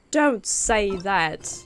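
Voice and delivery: ordinary delivery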